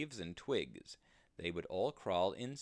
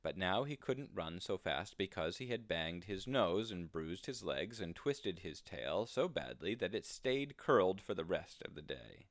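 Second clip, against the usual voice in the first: ordinary delivery, nothing marked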